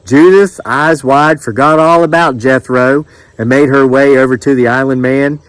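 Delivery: ordinary delivery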